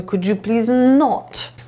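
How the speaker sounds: slow phrase landing firmly on not; not stretched and emphasised at the end; stress on not. Shown as a demonstration. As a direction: lean hard on not, drawn out. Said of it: "warto jest to not właśnie tak zaakcentować".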